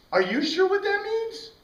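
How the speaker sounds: high, incredulous question; teasing disbelief at the student; mock incredulous. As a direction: toss it back in high disbelief. Teacher mocks the question back.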